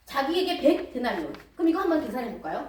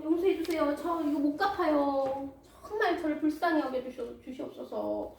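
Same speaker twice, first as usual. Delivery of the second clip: pleading debtor; 4 semitones higher than usual. Acted. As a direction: beg the master like a desperate servant. Debtor begs master for mercy.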